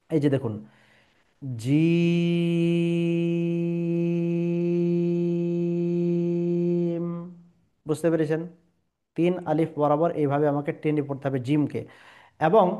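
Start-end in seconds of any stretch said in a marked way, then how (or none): 1.4-7.1 জিম with a very long, level ee, closing on m; drawn out three alifs. Shown as a demonstration. Teacher demonstrates the letter, জিম stretched to three alifs. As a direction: hold জিম on one steady note for several seconds. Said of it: "আমি আপনাকে জিম উচ্চারণ করে শোনাই".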